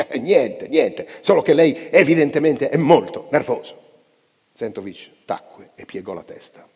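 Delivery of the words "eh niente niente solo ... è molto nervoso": loud, higher, sneering taunt; malicious mockery. A narrator's reading. Chess player taunting his rival, loud sneering mockery. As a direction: needle him with a nasty laugh in the voice. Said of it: "un'altra risata maligna".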